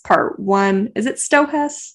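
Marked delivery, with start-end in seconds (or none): none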